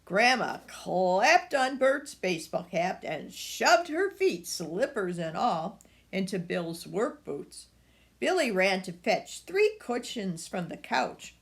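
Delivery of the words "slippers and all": ordinary delivery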